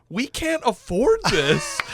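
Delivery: high, slow, loud alarmed protest; alarmed wife. Retold or imitated. Wife protesting to husband they can't afford it, loud. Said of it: "And she's like".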